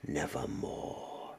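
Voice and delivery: hushed, breathy bird croak; whispered raven. Acted. Raven's one-word reply.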